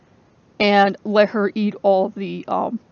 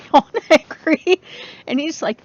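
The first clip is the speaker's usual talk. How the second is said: usual speaking voice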